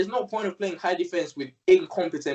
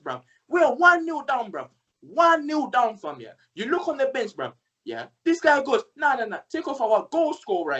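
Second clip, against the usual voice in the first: fuming rant; 7 semitones higher than usual. Acted. Fan re-enacting his angry voice notes, high and heated. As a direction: go off like a furious fan.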